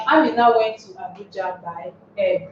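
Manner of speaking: "Amina" pushed out at the start; stress on Amina. Shown as a demonstration. Teacher reading the exam sentence aloud, Amina stressed. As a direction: hit "Amina" first and hardest. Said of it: "the emphasis is on Amina".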